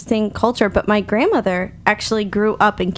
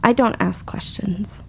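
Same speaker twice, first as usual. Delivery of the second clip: whisper; 2 semitones lower, 6 dB quieter, slower than usual. Real, not acted. Whispered aside.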